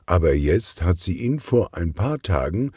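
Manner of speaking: plain reading voice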